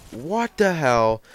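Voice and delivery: incredulous, slow exclamation; retold disbelief. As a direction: act out stunned exasperation, stretching the words. Retold shocked reaction to missing sauce.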